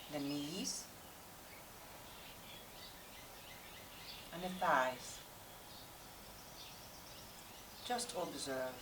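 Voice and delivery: calm, unhurried yoga meditation with long pauses; guided meditation. Real, not acted. Yoga teacher settling class before practice, guided meditation voice. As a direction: guide the class inward, leaving space between instructions.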